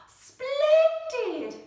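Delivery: high, loud thrilled exclamation; delighted cry. Acted. Teacher praising the star leap, delighted cry. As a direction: burst out with delight. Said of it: "cried Madame Plier".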